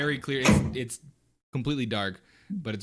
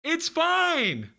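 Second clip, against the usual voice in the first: very high, loud shout across a room; Moo calling out; 5 dB louder than usual. Acted. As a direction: yell it out in a squeaky, high voice. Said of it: "he calls out to you".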